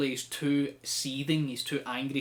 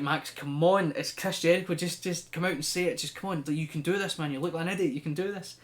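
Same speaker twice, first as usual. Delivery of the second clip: raised, urgent mock urging; self pep talk; 4 semitones higher, 4 dB louder than usual. MJF urging himself to say it to Jericho, exasperated. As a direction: egg yourself on, exasperated.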